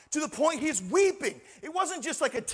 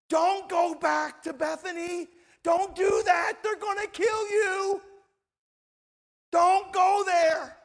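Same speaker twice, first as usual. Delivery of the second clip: mildly alarmed, higher-pitched pleading; urgent warning; 5 semitones higher than usual. Retold or imitated. Disciples warning Jesus off Bethany, urgent acted plea. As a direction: plead with him to stay away, voice raised in pitch. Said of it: "telling them".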